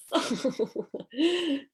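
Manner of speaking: short high laugh; bright laugh. Real, not acted. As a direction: laugh along, amused. Laughing at jealousy remark.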